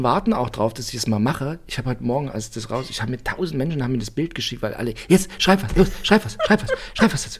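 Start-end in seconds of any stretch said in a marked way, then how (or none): 5.1-7.4 higher, urgent nagging voice; pushy urging. Retold or imitated. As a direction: nag insistently like impatient fans. Quoting pushy followers.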